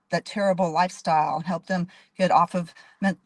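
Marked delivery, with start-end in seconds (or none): none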